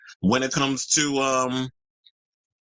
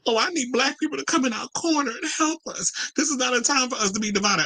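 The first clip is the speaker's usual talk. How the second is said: high-pitched mock-crying plea; mock whining. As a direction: imitate whiners pleading, pitched way up. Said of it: "who sit out here whining and crying".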